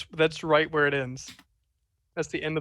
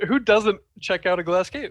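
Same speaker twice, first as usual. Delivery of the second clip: higher and louder, through laughter; laughing repeat; 4 semitones higher, 8 dB louder than usual. Real, not acted. Player insists to the group, laughing and louder. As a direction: throw the line back at them, laughing.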